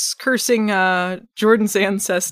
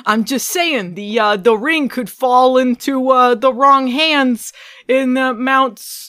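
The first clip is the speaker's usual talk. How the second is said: hammed-up mob threat, louder, higher; smiling menacing loan shark; 6 semitones higher, 7 dB louder than usual. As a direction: lean on them with a grin. Continues acted gangster scene.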